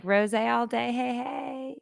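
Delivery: mock party-girl whoop, drawn out; pretend party cheer. Pretending to be a carefree wine drinker, singsong cheer.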